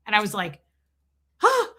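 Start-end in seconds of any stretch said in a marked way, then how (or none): 1.4-1.7 very high, loud startled "huh"; shocked gasp. Retold or imitated. Mother reacting to being screamed at, mock shock. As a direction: imitate being taken aback, sharp and high.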